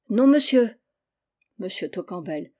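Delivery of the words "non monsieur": higher, louder chorus-like reply; raised voice for the children; several children answering. A narrator's reading. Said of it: "Plusieurs voix".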